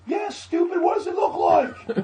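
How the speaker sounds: very high, loud scornful yell; heckler's jeer. Retold or imitated. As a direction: shout down the host from the crowd. Heckler answers the clown.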